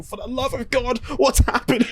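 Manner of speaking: high-pitched mock despair; exasperated wail. [name] amid the chaos, exasperated wail. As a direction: wail it to the heavens.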